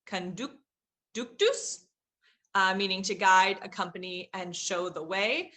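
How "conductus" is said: slow, careful "Conductus" with each syllable drawn out; Latin word read out. Shown as a demonstration. Teacher carefully sounding out a Latin word for students. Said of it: "forgive me on my pronunciation".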